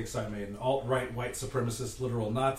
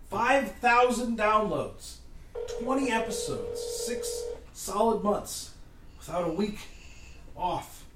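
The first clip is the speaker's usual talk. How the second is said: slow emphatic boasting; 6 semitones higher, slower than usual. Acted. Touting the podcast's stats.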